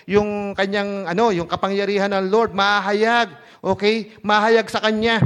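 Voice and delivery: normal talking voice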